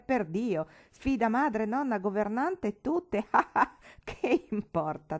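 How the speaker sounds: put-on hearty laughing old uncle. A narrator's reading. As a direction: laugh through the words, delighted. Rise a più non posso.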